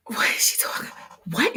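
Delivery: whispered, incredulous aside; disbelieving whisper. Retold or imitated. Stylist whispering her shock at the mentor's correction. Stunned private reaction to criticism.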